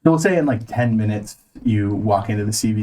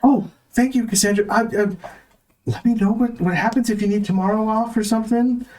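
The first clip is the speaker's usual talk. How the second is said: high-pitched flustered store manager; high nervous manager voice; 8 semitones higher than usual. Acted. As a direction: play a jittery, high-voiced boss. Manager thanking employee.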